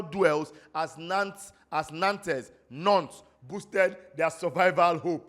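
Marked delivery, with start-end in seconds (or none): 1.9-2.5 Nantes read as spelt, "NAN-tez"; mistaken pronunciation. Real, not acted. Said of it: "not Nantes". 2.7-3.2 Nantes as one syllable, "nonts"; corrected pronunciation. Real, not acted. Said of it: "Remember, it's Nantes".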